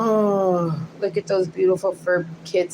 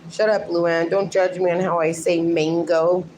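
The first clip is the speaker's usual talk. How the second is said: normal talking voice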